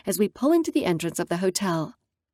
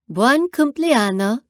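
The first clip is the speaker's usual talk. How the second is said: slow, loud, higher birthday cheer; celebrating; 4 semitones higher, 5 dB louder, slower than usual. Acted. Natalia toasting her friend's birthday, loud slow cheer. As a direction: toast it like a party host. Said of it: "Natalia celebrates".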